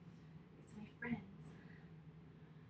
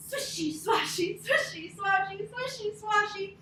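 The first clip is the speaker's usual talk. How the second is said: high, lively swishy chant; swishing grass chant; 7 semitones higher, 5 dB louder than usual. Acted. Story teacher to kids, swishy grass chant.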